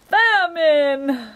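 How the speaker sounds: high, very loud wail; anguished roar. A narrator's reading. Humbug realizing what he has eaten, anguished roar. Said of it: "roared the anguished humbug".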